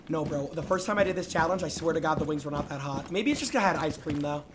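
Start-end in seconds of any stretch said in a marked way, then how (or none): none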